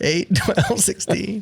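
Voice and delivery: repeating the numbers through a laugh; laughing echo. Real, not acted. Host amused, repeating the player's even-number guess, laughing. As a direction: giggle while echoing his answer.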